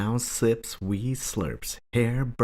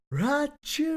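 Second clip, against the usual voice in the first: a sudden very high, explosive sneeze; big bear sneeze. A narrator's reading. Bear woken by pepper, one high explosive sneeze. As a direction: burst out with a huge sneeze. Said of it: "He blows and he sneezes".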